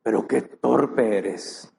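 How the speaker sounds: slow, blunt scolding "qué torpe eres"; a gentle but firm rebuke. Acted. Jesus rebuking the sad disciples, slow and blunt. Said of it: "¿qué le dice?".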